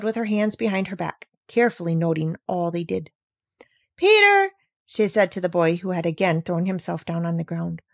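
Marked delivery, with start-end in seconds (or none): 4.0-4.5 high, loud call of a name; raised, high-pitched story-character call. A narrator's reading. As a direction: call out to get the boy's attention. Girl calls out to the boy.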